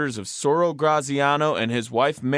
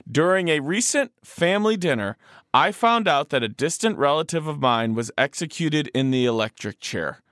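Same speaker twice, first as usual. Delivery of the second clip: usual speaking voice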